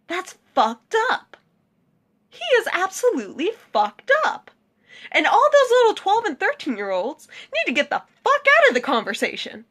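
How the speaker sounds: high, heated outburst; angry. Real, not acted. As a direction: let the outrage spill over. Angry rant against James Charles.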